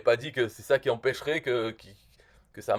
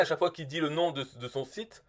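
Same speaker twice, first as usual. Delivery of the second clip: ordinary talk, nothing marked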